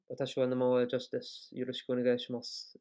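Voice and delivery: Japanese said with a small, tight mouth; a touch tighter Japanese demo. Shown as a demonstration. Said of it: "it's a little bit tighter".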